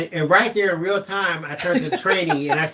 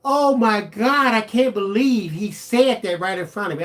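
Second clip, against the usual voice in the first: shocked diners; 5 semitones higher, 4 dB louder than usual. Retold or imitated. Retold diners reacting to his remark, shocked and high. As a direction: play the stunned table. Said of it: "the table looked at me like".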